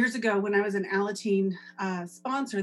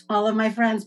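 normal talking voice

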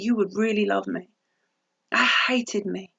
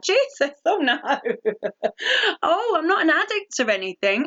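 higher-pitched, laughing protest; mock-indignant denial; 5 semitones higher than usual. Newcomer denying she's an addict to helpline man, mock indignant.

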